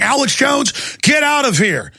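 high imitated shout of a panicked Marine; freaked-out yell. Plainclothes Marine ordering Alex Jones out, panicked shout. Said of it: "freaking out".

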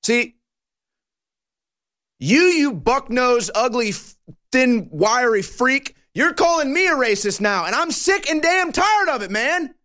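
high, furious tirade at the screen; angry rant. Real, not acted. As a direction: let him have it, pitch climbing in outrage. Ranting at a politician on screen.

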